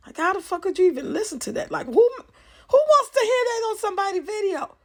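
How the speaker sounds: high, loud, fast exasperated rant. Real, not acted. Vlogger ranting at viewers about noisy kids on lives, high loud exasperation. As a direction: vent it like you can't believe it.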